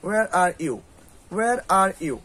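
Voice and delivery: "Where" said as "wer", the h left silent; teacher models "Where are you?" slowly, h dropped; where without h sound. Shown as a demonstration. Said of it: "এইচ উচ্চারণ হবে না।". ("Where" শব্দে h উচ্চারণ না করে "ওয়ের" বলা)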